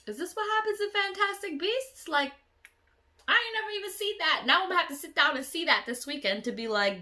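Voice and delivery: high, loud, fast incredulous; baffled. Real, not acted. Vlogger puzzling over the dream, high and incredulous. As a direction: wonder aloud in disbelief. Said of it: "waking up confused".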